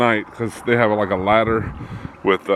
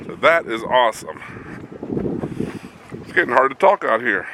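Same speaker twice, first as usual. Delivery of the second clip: cold stiff lips; talking through a frozen mouth; mouth freezing. Real, not acted. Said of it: "My mouth's freezing up".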